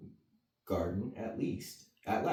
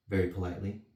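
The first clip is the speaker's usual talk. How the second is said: usual speaking voice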